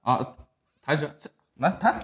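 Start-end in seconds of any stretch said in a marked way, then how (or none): none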